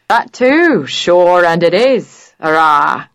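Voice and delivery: teasing a friend in a put-on Irish lilt; playful fake Irish brogue with a wink; mock Irish accent. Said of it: "in a mock Irish accent".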